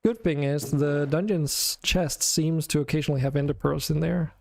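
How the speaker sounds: ordinary talk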